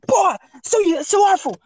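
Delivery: high-pitched mock furious yelling; angry insult outburst. Gaming partner blowing up at him mid-match, imitated high and angry. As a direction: spit out insults in a mimicked high rage.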